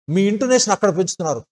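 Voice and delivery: ordinary delivery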